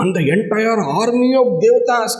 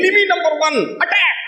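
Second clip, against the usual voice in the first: battle cry; 10 semitones higher, faster than usual. Acted. Father bird commands the flock.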